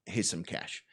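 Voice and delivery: low, very quiet acted dad voice; muttering dad. Dad agrees to hand son some cash, muttering. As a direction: mumble it under your breath.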